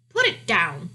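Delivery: loud, firm royal order; commanding king. A narrator's reading. King orders his daughter to drop the mouse, commanding. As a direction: give a curt order as the king. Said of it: "the king commanded".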